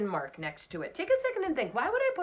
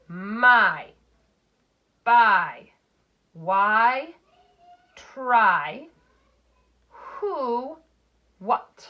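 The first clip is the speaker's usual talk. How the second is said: each word called out loud for the class to echo; loud choral reading; 7 dB louder, much slower than usual. Shown as a demonstration. Teacher leading the class in reading words aloud. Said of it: "Let's read these words together".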